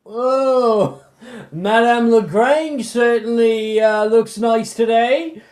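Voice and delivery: put-on a drunk loafer calling out, drawn out and loud; passerby. Drunk passerby calls out to Camille, leering and loud. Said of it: "He goes".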